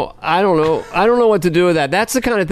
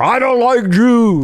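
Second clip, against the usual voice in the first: slow, higher caricature of a disgraced president; Richard Nixon; 4 semitones higher, slower than usual. Acted. President posting on Twitter, slow hammed-up Nixon voice. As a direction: draw it out in a hammed-up presidential impression. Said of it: "I do if Nixon had Twitter".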